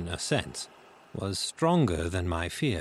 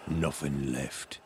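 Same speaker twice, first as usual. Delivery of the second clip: slow, low, soft sailor's voice; low-voiced pirate; 7 semitones lower, 5 dB quieter, slower than usual. A narrator's reading. Searcher at inn door to blind man, low slow reply. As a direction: drop low and draw it out. Same pirate continues report.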